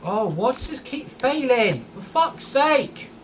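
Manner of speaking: rising to a furious yell at the game; angry outburst. Real, not acted. Gamer raging at the failing game, furious yell.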